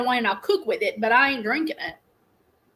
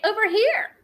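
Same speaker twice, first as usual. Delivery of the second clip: imitating Siri; 9 semitones higher than usual. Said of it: "'cause she'll say".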